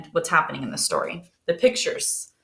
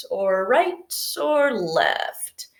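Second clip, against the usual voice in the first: slow, whispered musing; Buddy muttering to himself, whispering and confused; puzzled whisper; much slower than usual. Acted. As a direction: whisper it slowly, lost in thought.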